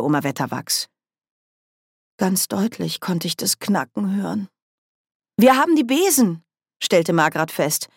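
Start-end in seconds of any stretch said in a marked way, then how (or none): 5.4-6.4 put-on eager young witch. A narrator's reading.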